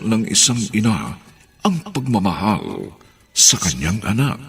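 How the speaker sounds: low, slow announcer read; radio announcer. Acted. The host invites listeners to the final chapter, announcer voice. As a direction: invite listeners to the next episode.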